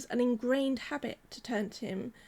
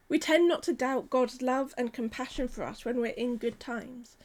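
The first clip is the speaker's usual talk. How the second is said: ordinary delivery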